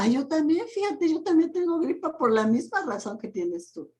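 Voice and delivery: high, fast, eager me-too whine; mock hypochondriac. Teacher mocking a coach who shares the client's complaints, eager me-too. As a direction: play the copycat who just has to match every symptom.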